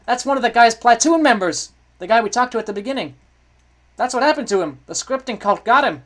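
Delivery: loud, high-pitched cries of alarm; alarmed shouting. Pretend. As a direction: shout it out as a shocking discovery. Alarmed reaction to in-game discovery.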